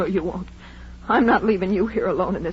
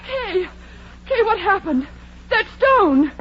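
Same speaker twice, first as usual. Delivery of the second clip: high, breathless alarm; frantic after the blow; shocked panic; 9 semitones higher than usual. Acted. Kay cries out in panic over bleeding Edna.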